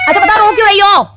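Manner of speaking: high, loud demand; mother asking her crying daughter, loud and high; loud scold. Real, not acted. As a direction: push her to answer.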